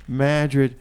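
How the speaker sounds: ordinary talk, nothing marked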